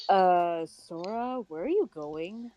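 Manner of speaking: low, mature lady voice; mature woman voice. Acted. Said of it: "does my voice even work for Setsuka?".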